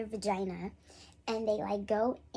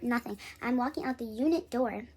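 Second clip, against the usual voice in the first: ordinary talk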